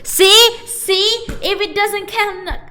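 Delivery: high-pitched, loud protest; exasperated outburst. Real, not acted. Streamer failing a British accent for chat, high exasperated protest.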